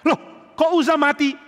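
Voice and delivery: high, startled cry; shocked crowd. Retold or imitated. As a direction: gasp out the question in shock. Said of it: "Semua kaget".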